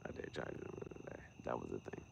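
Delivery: fast whispered repeat. Real, not acted. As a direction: hiss it to yourself quickly.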